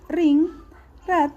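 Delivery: usual speaking voice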